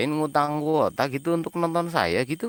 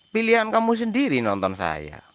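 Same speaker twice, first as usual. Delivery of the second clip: usual speaking voice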